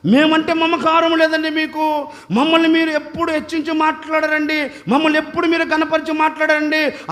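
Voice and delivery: hurt, whiny list of grievances; complaining woman. Acted. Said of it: "ఆ అమ్మ అంటుంది".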